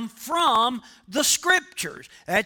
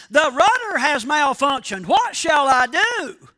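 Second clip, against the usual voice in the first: a raised, panicky cry for help; radio the tower in a panic, louder; panicked shout; 4 semitones higher, 6 dB louder than usual. Retold or imitated. Pilot radioing the control tower in a panic, a raised panicky shout. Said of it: "in a panic and he shouted".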